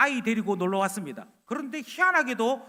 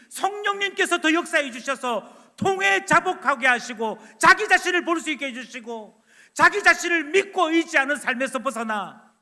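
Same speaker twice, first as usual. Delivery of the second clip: fervent prayer, rising and louder, slowing on the plea; teacher's prayer; 4 semitones higher, 6 dB louder than usual. Retold or imitated. Teacher prays to the Lord for a student, fervent. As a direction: pray earnestly for the student. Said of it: "기도해야 됩니다".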